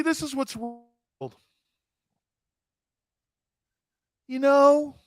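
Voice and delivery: high, huffy, hammed-up offense; mock offended snowflake. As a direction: play a prissy, wounded accuser. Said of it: "why I'm acting like that".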